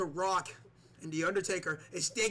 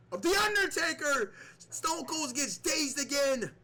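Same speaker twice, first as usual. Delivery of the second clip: excited commentary; 10 semitones higher, 3 dB louder than usual. Acted. Tombstone finisher, commentator erupts.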